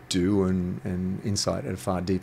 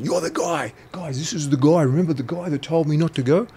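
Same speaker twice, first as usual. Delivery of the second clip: much higher, louder call to his mates; excited recognition; 9 semitones higher, 5 dB louder than usual. Retold or imitated. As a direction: announce him to the room with a jolt of recognition. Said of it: "He said".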